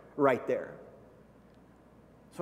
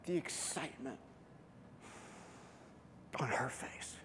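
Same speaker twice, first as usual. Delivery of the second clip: hushed tender memory; 3 semitones lower, 11 dB quieter than usual. Real, not acted. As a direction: tell it softly, moved by the memory.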